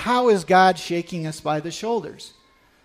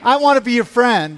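raised voice, calling out love; loud affectionate call; 4 semitones higher, 7 dB louder than usual. Shown as a demonstration. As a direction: call it out so they really hear it. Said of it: "and say".

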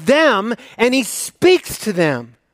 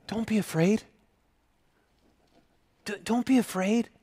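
gentle reassurance; 3 semitones higher, 9 dB quieter than usual. Acted. Moses reassuring the frightened people.

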